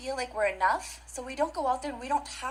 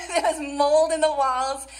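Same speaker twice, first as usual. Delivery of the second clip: laughing through it, a bit louder; 3 semitones higher, 7 dB louder than usual. Real, not acted. Telling a crowd about the moldy trailer, laughing. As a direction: chuckle your way through the line.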